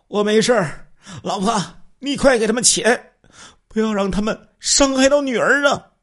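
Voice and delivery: husband shouting from the bedroom in panic; panicked voice. Acted. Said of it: "惊慌的声音了". (惊慌失措地喊)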